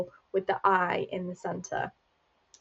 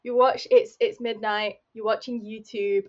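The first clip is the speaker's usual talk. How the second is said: usual speaking voice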